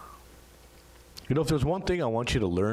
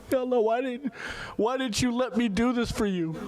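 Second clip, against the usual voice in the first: anguished plea. Retold or imitated. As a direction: cry out to God in grief. Prophet's anguished plea to God.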